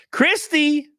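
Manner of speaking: high, loud, drawn-out announcer call; hyped shout-out. Real, not acted. Streamer announces Christy's late-night donation, loud hyped shout-out. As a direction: belt it out like a hype man. Hyping a donor's gift.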